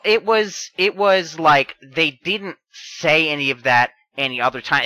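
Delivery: normal talking voice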